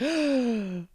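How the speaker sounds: high, sharp pretend gasp; mock shocked gasp. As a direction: gasp like a scandalized crowd. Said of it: "they'll be like".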